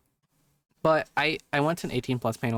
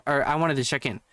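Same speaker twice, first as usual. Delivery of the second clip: ordinary talk, nothing marked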